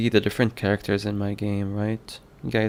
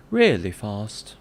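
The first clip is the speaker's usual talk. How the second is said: slow, loud put-on British accent; 6 dB louder, slower than usual. Shown as a demonstration. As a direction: stretch it out in a mock British accent. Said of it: "Maybe I can use a different British accent".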